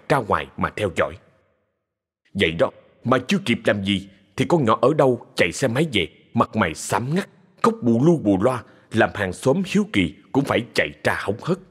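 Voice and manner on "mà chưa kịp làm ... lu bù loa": plain reading voice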